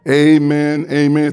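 earnest pastoral prayer, unhurried with pauses. Acted. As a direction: pray it aloud over the congregation. Pastor prays before the sermon.

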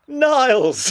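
high-pitched imitated summons; drawn-out high mock cry; mock calling out. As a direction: call the name out high, hammed up. Imitates character calling out for Niles.

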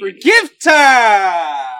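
announcer call. Real, not acted. Announcing gift time.

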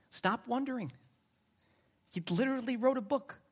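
exasperated punchline. Acted. Preacher scolding the doubting congregation, incredulous. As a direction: snap back as if it's obvious.

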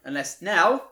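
calling out. Real, not acted. Calling someone into room.

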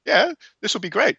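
high, fast, gushing fake delight; mock overjoyed. Telling Gregor the trip plan is perfect, high mock delight. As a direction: gush like it's the best news ever.

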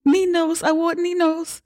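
put-on very high, pleading child's voice; little daughter refusing a new bunny; whining little girl. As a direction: beg like a kid who wants her bunny back. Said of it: "Agnes is like".

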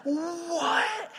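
high shouted disbelief; incredulous what. Real, not acted. Preacher reacting to the congregation, a high shout of disbelief. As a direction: throw up your hands at the absurdity. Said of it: "it's like".

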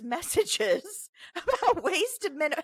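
trying to read through giggles; high, slow, breaking into laughter; laughing. Real, not acted. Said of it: "I don't know why I'm laughing".

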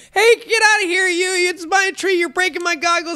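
very high, loud manic yell; Morty-style yelling. Retold or imitated. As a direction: shriek like a frantic cartoon kid guarding his tree. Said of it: "Morty yelling at you at the top of your lungs".

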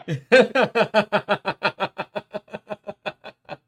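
loud, high burst of laughter. Real, not acted. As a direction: crack up hard. Reacts to meme.

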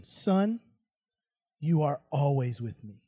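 soft, low, gentle reassurance; hushed fatherly patience; tender father. Acted. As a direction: reassure a hurt son quietly, with pauses. Father gently answers angry son.

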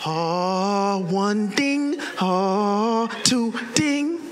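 mock-pious, sustained chant-like delivery; too holy. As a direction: intone it like a holy reading. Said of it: "trying to make it too holy".

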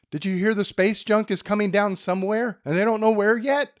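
loud, high panicked caller; breathless alarm about space junk. Retold or imitated. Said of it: "I get that phone call that".

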